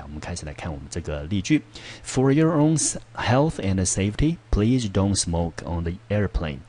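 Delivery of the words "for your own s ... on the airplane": slow, careful reading voice; example sentence read aloud. Shown as a demonstration. Teacher reads the example sentence to the class, slowly. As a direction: read the example clearly for learners.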